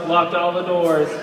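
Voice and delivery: ordinary talk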